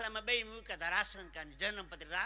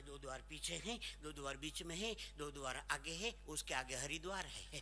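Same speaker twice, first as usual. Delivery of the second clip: mock hostess voice; 5 semitones lower, 6 dB quieter than usual. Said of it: "પછી બહાર નીકળી કે".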